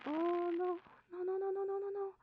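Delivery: high, rising run of dismayed noes. Acted. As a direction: panic at a ruined experiment. Realizes she messed up.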